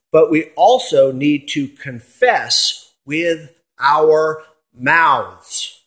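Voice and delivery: "confess with our mouths" slowed and stressed; emphasis on confess. Shown as a demonstration. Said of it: "I put the emphasis there on the confession part".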